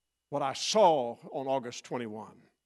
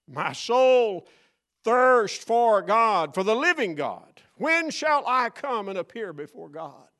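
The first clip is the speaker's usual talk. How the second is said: scripture read with fervor; 5 semitones higher, 6 dB louder than usual. Real, not acted. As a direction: lift the verse up, slow and louder.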